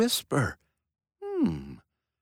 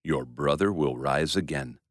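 Jesus comforting Martha at Lazarus's death, calm and low; 10 semitones lower, 4 dB quieter than usual. Acted. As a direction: lower and softer, gentle reassurance. Voicing Jesus calmly.

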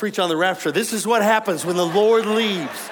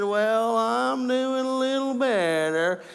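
feeble grandma; 4 semitones higher, much slower than usual. Retold or imitated. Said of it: "And she said".

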